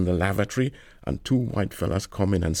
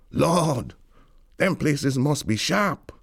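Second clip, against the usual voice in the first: higher, drawn-out wonder; awed exclamation; 7 semitones higher, slower than usual. Acted. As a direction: marvel at it, stretching the words. Imagined awe at famous places.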